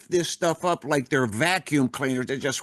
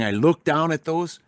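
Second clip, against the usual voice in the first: ordinary talk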